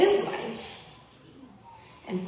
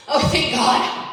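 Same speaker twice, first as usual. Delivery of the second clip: louder, slow, relieved sigh of words; relieved boyfriend; 3 semitones higher, 8 dB louder, slower than usual. Retold or imitated. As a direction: imitate his relief, drawn out and loud. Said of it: "And my boyfriend goes".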